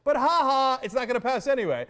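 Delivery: hammed-up gloating laugh-taunt; mock taunting. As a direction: sneer it like a smug anchor. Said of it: "the last thing that they got is".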